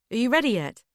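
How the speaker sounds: called out loud and impatient; yelled up the stairs. Acted. Husband yells up the stairs to his wife, impatient and loud. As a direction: shout it up the stairs, hurrying her. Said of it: "he yells up the stairs".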